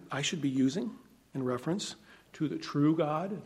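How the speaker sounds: ordinary delivery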